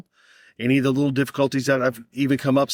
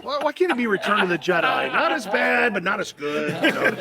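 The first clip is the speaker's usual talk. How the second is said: laughing; 7 semitones higher than usual. Real, not acted. Laughing through Star Wars joke.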